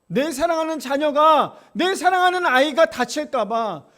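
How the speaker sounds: loud, high fervent preaching; preaching at full force. Acted. Pastor stirring the congregation, loud preaching. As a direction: press the point home passionately.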